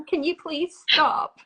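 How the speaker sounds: mock-exasperated request to people phoning her; playful plea. Real, not acted. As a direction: beg the callers to stop, half laughing. Joking plea to callers after laugh.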